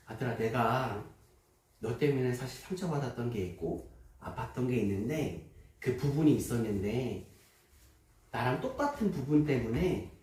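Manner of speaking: soft, low confession to his son; gentle father. Retold or imitated. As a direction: speak it quietly, from the heart.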